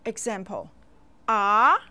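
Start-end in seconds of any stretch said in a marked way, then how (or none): 1.3-1.8 "A" rising from middle to high; second tone. Shown as a demonstration. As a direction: lift "A" upward like a question. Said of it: "begins and rises from middle to high".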